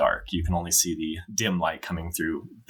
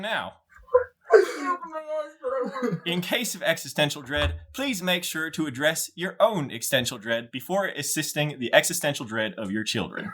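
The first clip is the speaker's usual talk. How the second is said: high-pitched, scripted airline announcer; put-on flight attendant voice; pre-flight announcer; 10 semitones higher than usual. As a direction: read absurd advice as official cabin procedure. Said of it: "you get a pre-flight announcement".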